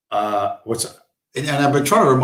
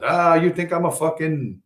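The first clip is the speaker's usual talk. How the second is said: mock indignation, higher and drawn out; dramatically insulted; 5 semitones higher, slower than usual. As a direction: mimic a man taking huge offence. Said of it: "he does all this fucking dramatic, like he's insulted".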